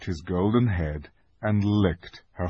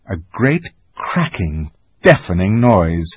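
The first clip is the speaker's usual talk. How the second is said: ordinary delivery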